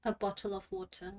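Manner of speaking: BOTTLE OF WATER with crisp T's, no final R; soft, low London accent; quiet British accent, T's sounded. Shown as a demonstration. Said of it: "at least you do the T's".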